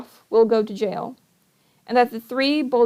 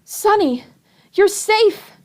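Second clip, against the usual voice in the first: much higher, joyful relief; relieved cry; 10 semitones higher, 3 dB louder, slower than usual. Acted. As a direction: burst out with relief. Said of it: "Klaus cried".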